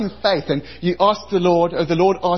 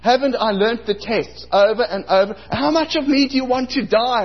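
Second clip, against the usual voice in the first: high, loud, exasperated plea to the Lord; high pitched exasperated protest; indignant complaint; 7 semitones higher, 3 dB louder than usual. Retold or imitated. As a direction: whine at God in outrage. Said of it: "I was enraged".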